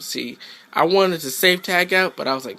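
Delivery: ordinary talk, nothing marked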